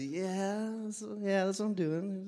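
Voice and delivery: high, soft, unsure yeah; mildly acted sheepish bluff; unconvincing agreement. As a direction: feebly go along with his guess. Bluffing to suspicious customs man.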